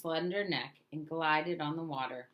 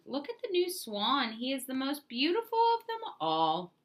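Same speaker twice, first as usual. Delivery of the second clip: put-on a child's bright exclamation, higher; excited child exclaiming; 7 semitones higher than usual. A narrator's reading. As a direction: call out in wonder like a child spotting the swan. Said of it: "one of them exclaimed".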